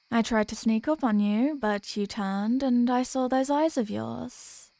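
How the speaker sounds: ordinary talk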